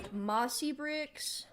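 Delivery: usual speaking voice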